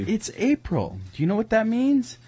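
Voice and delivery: high, loud ad announcer; commercial voice. Pretend. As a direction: sell it like a radio ad. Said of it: "I feel like a commercial".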